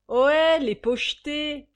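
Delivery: appel crié, plus haut et bien plus fort. Acted. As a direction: interpelle toute la salle en criant. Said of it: "s'écria tout à coup la môme". (cri aigu et très fort)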